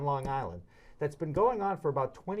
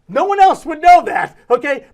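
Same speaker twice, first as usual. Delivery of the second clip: much higher and louder, delighted; excited punchline; 14 semitones higher, 18 dB louder than usual. Real, not acted. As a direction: burst out with glee.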